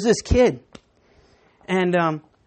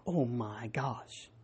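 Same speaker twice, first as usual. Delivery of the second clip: stunned hush; 9 semitones lower, 16 dB quieter, much slower than usual. Retold or imitated. Boy sees dead duck.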